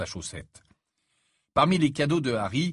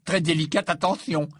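higher, teasing mock praise; mock hilarity; 5 semitones higher, 4 dB louder than usual. A narrator's reading. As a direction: praise it as if about to laugh. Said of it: "dans un grand éclat de rire". (moquerie amusée, presque rieuse)

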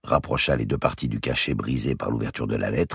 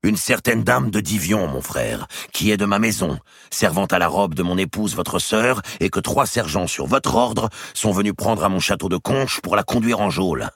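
high, loud, wounded pride; indignant Robert; 7 semitones higher, 4 dB louder than usual. Acted. Robert protesting to his brother the king, indignant and loud. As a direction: storm in and throw it all back at the king.

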